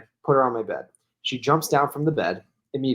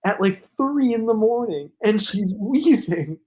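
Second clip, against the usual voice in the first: words breaking into real laughter, pitched up; laughing while telling; 9 semitones higher than usual. Real, not acted. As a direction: giggle through the end of the story. Laughing while retelling dog story.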